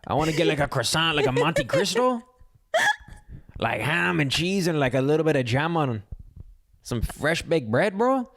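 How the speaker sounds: mock French accent. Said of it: "my French, I'm like".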